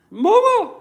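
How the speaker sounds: put-on high, loud whiny wail of a scared kid; mock the crybabies calling for mommy; mock crybaby. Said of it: "They're gonna cry and call".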